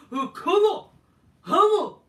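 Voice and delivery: shouted high and loud, hammed up; hammed-up fan shout. Orton imitated whipping up the fans with a hammed-up shout. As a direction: yell it out like a wrestler hyping the crowd.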